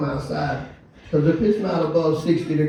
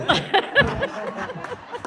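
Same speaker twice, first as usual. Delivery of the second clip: high laugh. Real, not acted. Big laugh.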